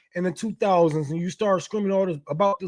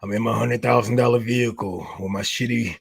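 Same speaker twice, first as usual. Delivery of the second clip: much lower, heavy mopey fan voice; mock sulking fan; 11 semitones lower than usual. Commentator mocks a betrayed fan in a much lower, mopey voice. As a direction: drag it low like a whiny, beaten-down fan.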